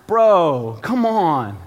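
half-laughing exasperated protest; exasperated plea. Retold or imitated. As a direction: beg the baby to stop, fed up. Baby has diarrhea in sink.

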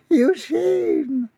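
falsetto name, held back; mother's hushing call. A narrator's reading. Mother shushes her son in the dark, small high call.

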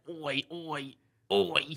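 repeated Australian oi, rising in pitch; playful mock-Australian chant; Australian accent. Shown as a demonstration. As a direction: demonstrate the Aussie call. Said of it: "that's an Australian thing".

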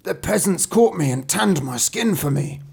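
wailing wolf, pitched high; high, hammed-up wolf complaint; crying out. A narrator's reading. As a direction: cry out the grievance as a beaten wolf. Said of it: "cried he".

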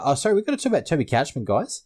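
ordinary talk, nothing marked

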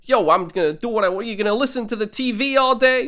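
hammed-up New York rebel rant; rebellious teen. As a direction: jeer like a rebel taunting his siblings. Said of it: "he's very much in that reb-, very, very rebellious".

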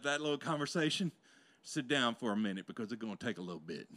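usual speaking voice

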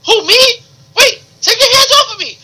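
high-pitched protesting character voice; Miguel. Pretend. Intruder objecting to being thrown out, high comic protest. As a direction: squeal in put-on indignation.

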